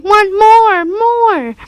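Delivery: put-on high, drawn-out child's wail; crying toddler demand. A narrator's reading. Little boy to his father over binned omelet, tearful wail. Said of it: "Fudge started to cry".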